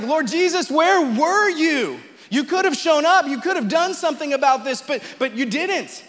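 a grieving sister's bitter reproach, pitched high, fading at the end; frustrated, bitter. Retold or imitated. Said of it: "frustration and confusion and anger and bitterness".

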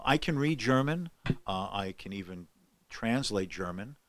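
ordinary talk

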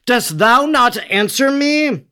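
high, emphatic narrated question; insistent demand. A narrator's reading. Pilate questioning the silent Jesus, insistently demanding. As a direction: press for an answer, pitch lifted high.

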